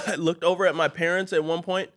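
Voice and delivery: usual speaking voice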